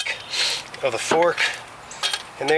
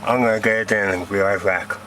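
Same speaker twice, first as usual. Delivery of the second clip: flashlight in mouth; 5 semitones lower than usual. Real, not acted. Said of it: "I got a flashlight in my mouth".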